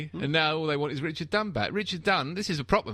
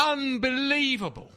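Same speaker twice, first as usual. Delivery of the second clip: hammed-up disbelief; 11 semitones higher, slower than usual. Mock disbelief at transfer fee.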